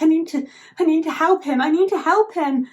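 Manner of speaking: high, pleading mock desperation; urgent plea. Asher grabbing the spell book to help him, acted desperation. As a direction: plead it urgently, pitch lifted.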